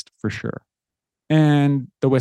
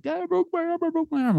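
very high falsetto imitation of a boy; mock pained wail, thin squeal; wailing hurt child. Hurt son crying out to dad about his arm, mock wailing.

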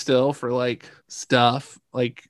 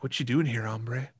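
cowboy attitude. Retold or imitated. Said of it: "cowboy almost attitude".

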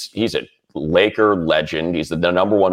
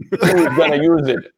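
coworker voice; 9 semitones higher, 3 dB louder than usual. Retold or imitated. Acted exchange with Michael.

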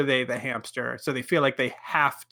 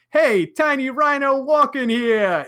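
a loud, higher mock New York shout; New York accent; 9 semitones higher, 4 dB louder, slower than usual. Someone reading a rhino comment, New York accent. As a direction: bark it like a New Yorker on the street. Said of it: "in my best New York accent".

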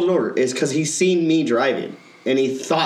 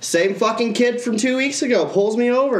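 usual speaking voice

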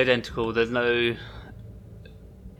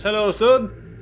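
high, slow called-out greeting; shouting from a distance; 10 semitones higher, 4 dB louder, slower than usual. Acted. Detective calling to the stranger from afar, high drawn-out shout. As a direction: call it out across the room. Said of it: "just shouts from a distance".

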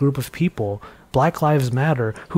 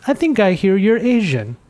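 caller's voice; 6 semitones higher than usual. Retold or imitated. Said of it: "the person on the other line said".

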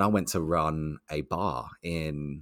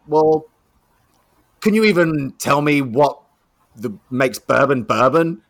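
loud, higher-pitched challenge; grilling; 6 semitones higher, 8 dB louder than usual. Retold or imitated. Rep demanding the new guy explain bourbon, grilling. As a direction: imitate a rep demanding an answer, pushing hard. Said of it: "she's grilling me like this".

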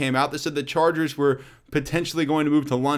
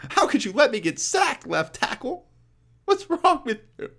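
high, whiny mock sob to his lineman; mock crying quarterback; 9 semitones higher than usual. Phillip Rivers blaming his left tackle, high whiny mock crying. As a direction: whine up high like a crybaby quarterback. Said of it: "He's always crying".

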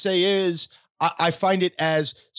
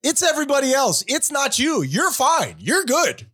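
mock reassurance; 7 semitones higher than usual.

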